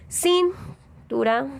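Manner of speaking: "Cintura" with CIN punched high, then a gap before tura; misplaced stress on first syllable. Shown as a demonstration. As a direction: hit the CIN of "Cintura" hard and long. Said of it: "en donde lleva el acento".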